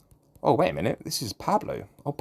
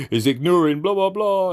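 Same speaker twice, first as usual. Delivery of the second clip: high mocking singsong; 8 semitones higher, 4 dB louder than usual. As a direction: sneer it in a high voice. Mocking troll's message.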